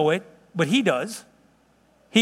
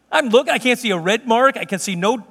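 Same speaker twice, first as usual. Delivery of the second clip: ordinary talk, nothing marked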